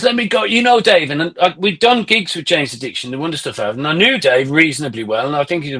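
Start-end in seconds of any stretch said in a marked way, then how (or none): 0.0-1.1 lightly imitating an eager friend, higher pitched; high, keen quoted voice; Henky Panky. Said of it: "he goes".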